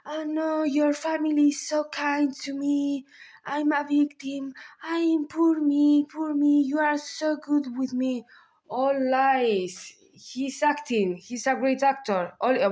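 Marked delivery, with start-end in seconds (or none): 0.1-8.2 higher, whiny poor-me pleading; pitiful victim act. Retold or imitated. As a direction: play the fake helpless victim. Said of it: "he starts his pantomime with Vinicia".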